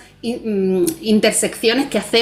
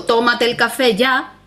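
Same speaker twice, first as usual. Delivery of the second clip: command; 3 semitones higher than usual. Shown as a demonstration. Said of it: "para marcar una orden".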